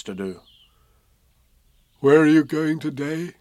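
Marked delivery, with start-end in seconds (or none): 2.0-3.3 gentle bear asking a friend; mild Pooh voice; lightly voiced Pooh bear.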